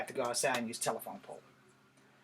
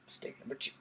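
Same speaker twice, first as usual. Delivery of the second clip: whispered wry aside, no voice; 9 dB quieter than usual. A narrator's reading. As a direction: breathe it out as a knowing whisper. Wry narrator aside, repeated.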